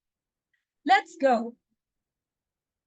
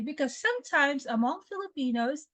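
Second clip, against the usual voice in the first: ordinary talk, nothing marked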